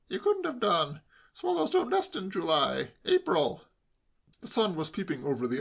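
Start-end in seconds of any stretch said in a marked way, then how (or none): 0.1-3.6 raised-pitch correction; know-all nephew. A narrator's reading. Nephew disproving uncle's excuse, know-all. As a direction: insist on the fact in a higher voice.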